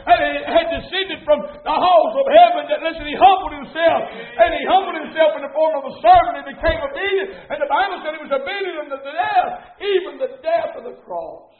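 loud, climbing pulpit cadence; fiery, punched sermon climax; full-force preaching. Real, not acted. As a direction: build it into a fervent proclamation.

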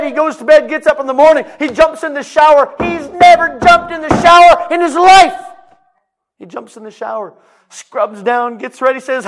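high, loud preacher building excitement; excited preacher narrating a miracle; fiery storytelling preaching. Acted. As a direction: preach the story with rising fire. Preaching at full force.

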